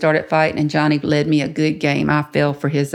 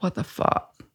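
whispered, drawn-out disbelief; baffled hushed aside; 6 dB quieter, slower than usual. Retold or imitated. Woman recalling buyer's odd demand, baffled whisper. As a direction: mutter it softly under your breath. Baffled when told to remove glasses.

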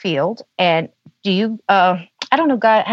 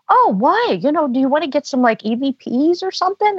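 surprised question; 7 semitones higher than usual. Retold or imitated. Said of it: "And he said".

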